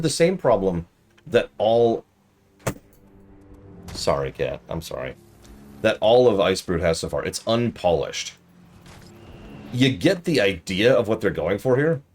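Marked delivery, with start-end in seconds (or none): none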